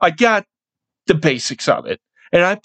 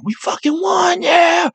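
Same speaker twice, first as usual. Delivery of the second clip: high-pitched mock cheer; retold celebration; 13 semitones higher than usual. Fan at home freaking out watching the game, high excited shout. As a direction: re-enact a shouted victory yell.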